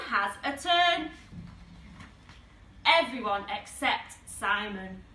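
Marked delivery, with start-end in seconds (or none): none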